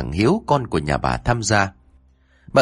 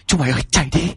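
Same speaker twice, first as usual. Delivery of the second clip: hoảng hốt hô hoán; 5 semitones higher, much faster than usual. A narrator's reading. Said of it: "Cả đám hô hoán".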